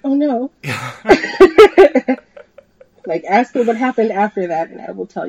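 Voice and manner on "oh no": mock dismay. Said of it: "I was like".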